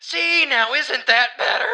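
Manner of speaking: loud, high, slow mock-cheery relief. To listeners after the big sigh, in loud mock relief. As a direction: sing it out as if suddenly cured.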